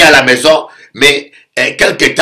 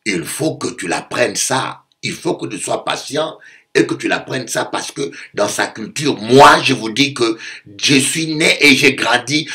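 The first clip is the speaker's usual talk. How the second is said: usual speaking voice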